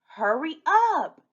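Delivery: high, loud, drawn-out urging; urgent command. Acted. Hurrying Red along to sick Grandma, urgent.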